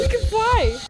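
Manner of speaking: high, loud, thrilled; excited shout. Real, not acted. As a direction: yell it with delight. Discovering flying in game.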